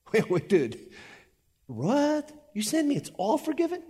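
high, incredulous voice of a puzzled reader; mock disbelief. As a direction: act floored by the news, pitch way up. Imagined reader questions Paul.

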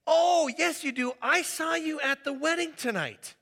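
high, loud, put-on "Oh, yes, you do"; insistent guest. Wedding guest insisting to the pastor, high and loud. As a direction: insist eagerly you were there.

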